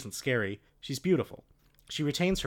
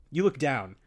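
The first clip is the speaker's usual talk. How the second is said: ordinary talk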